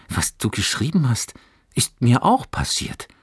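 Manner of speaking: excited call. Acted. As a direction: burst out with enthusiasm. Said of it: "rief er begeistert". (begeistert gerufen, etwas höher)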